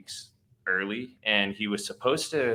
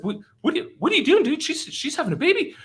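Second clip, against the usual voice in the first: very high-pitched mimicked fluster; elevated excited voice; 4 dB louder, faster than usual. Buddy questioning the man filling the tub, high flustered voice. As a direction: stammer it high, like a buddy panicking. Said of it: "his voice starts to elevate".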